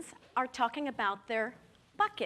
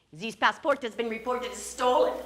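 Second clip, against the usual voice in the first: put-on louder French-accented accusation; French-accented verdict; 7 dB louder than usual. French officer to Ginger, French-accented verdict. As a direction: deliver the bad news officially.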